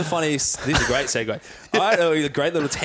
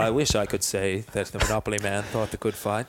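Morgan Freeman; 6 semitones lower than usual. Acted. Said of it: "do you know the great Morgan Freeman impersonation".

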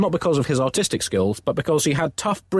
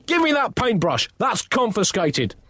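stern; 9 semitones higher than usual. Acted. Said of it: "Pope confiscates".

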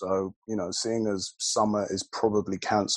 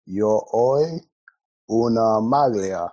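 put-on Italian in a Nigerian accent; slow, loud Nigerian-accented Italian; 3 semitones higher, 7 dB louder, much slower than usual. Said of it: "why are you speaking in a Nigerian accent".

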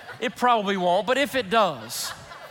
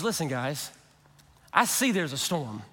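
much softer and lower, calm and kind; gentle reassuring Jesus; 8 semitones lower, 5 dB quieter than usual. Retold or imitated. Jesus calming his frightened disciples in a soft low voice. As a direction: soothe the scared disciples gently. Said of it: "did you hear the tone".

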